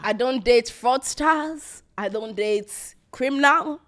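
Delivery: each word slowly stressed; slow and deliberate. Real, not acted. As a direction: spell it out slowly, firmly. Slow emphatic declaration.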